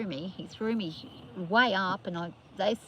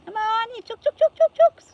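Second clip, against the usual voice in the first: high, loud calling chickens; sing-song call to round up chooks; 13 dB louder than usual. Real, not acted.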